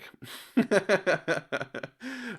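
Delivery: long, high run of laughter; hearty giggle. Real, not acted. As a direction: break into a rapid, high giggle. Laughs after thanking the commenter.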